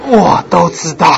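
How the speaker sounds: words through laughter, slow; laughing. Real, not acted. Preacher repeats the line while still laughing.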